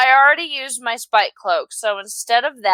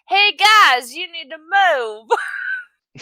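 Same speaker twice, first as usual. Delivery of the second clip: put-on southern accent; 7 semitones higher, much slower than usual. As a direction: drawl it like a southern good ol' boy. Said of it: "I don't know what happened".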